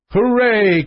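high, mildly excited cheer; joyful cry. A narrator's reading. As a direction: call out a cheer from the bed. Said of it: "cried the prince from the bed".

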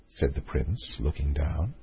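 usual speaking voice

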